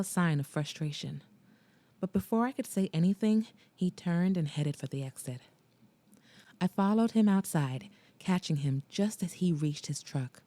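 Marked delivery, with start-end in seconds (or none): none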